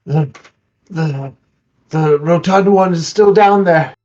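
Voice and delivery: gasping between words, panting; breathless after surfacing; out of breath. Acted. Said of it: "I'm breathing heavily".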